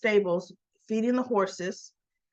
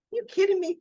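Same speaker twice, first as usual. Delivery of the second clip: high, scoffing you kidding me; mock disbelief; 10 semitones higher, faster than usual. A woman scoffing at fake IDs, high and mocking. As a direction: scoff at the very idea. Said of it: "She goes".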